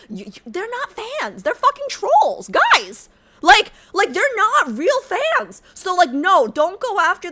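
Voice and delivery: high, loud ranting; heated rant. Real, not acted. Streamer warning chat about trolls, high and heated. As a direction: vent hard at the trolls.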